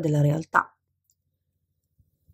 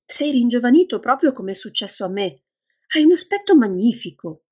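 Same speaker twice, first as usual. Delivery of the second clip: rising, louder amazed outburst as the line goes on; astonished cry; 5 semitones higher, 3 dB louder than usual. A narrator's reading. Lydia amazed at Lawrence turned young, astonished cry. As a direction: gasp it out, stunned by what she sees. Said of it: "cacciò un grido di stupore".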